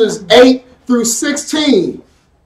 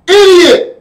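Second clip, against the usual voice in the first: scolding shout; 9 semitones higher, 4 dB louder than usual. Acted. Preacher scolding the crowd.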